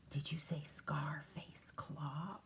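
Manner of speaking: hushed, slow, breathless whisper; scared whisper. Acted. Reader whispering a cat's fearful question. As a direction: whisper it like a frightened cat.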